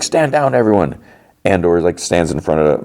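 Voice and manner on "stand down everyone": high-pitched retold order to a crowd; mock command. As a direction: imitate someone ordering everyone to back down. Pulls out blaster, orders crowd.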